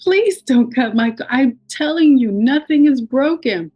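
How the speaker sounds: retold frantic protest; acted pleading. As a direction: beg them to stop, building in urgency. Retold plea to save the coat.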